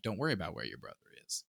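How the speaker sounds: low, soft, fast menacing murmur; cloaked man. Retold or imitated. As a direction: dismiss her coldly, sotto voce. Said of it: "the cloaked man's like".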